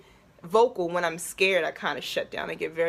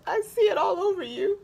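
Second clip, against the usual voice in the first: higher, crying through it; the tears come as you say it; voice breaking; 13 semitones higher, 4 dB louder than usual. Real, not acted. Retelling what the speaker said to her, crying.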